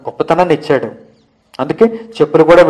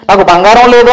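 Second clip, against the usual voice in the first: mildly mocking wail, raised in pitch; 4 semitones higher, 4 dB louder than usual. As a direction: mimic a whining cry of lack, half-heartedly. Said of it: "అని ఏడుస్తున్నాడు ఆయన". (వెటకారంగా ఏడుపు నటిస్తూ)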